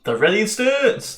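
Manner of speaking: high, slow grand declaration; mock dramatic. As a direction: ham it up like a title. Player mocks the phrase.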